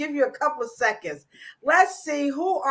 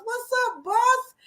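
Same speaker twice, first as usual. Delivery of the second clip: much higher, sing-song hello; excited greeting; 12 semitones higher, slower than usual. Real, not acted. Host greeting her boss in the chat, delighted and high.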